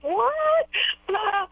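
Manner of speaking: squeaky high disbelief, way up; retelling her shocked reaction; incredulous what. Retold or imitated. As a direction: yelp it out in shock, pitch jumping high. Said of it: "And I was like".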